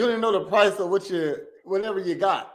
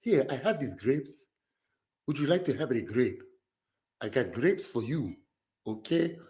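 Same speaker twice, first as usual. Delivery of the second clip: softer, lower African accent imitation, gentle seller; 6 semitones lower, 3 dB quieter than usual. Reactor mimics a soft-spoken African grape seller, soft lower African accent. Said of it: "the soft voice African".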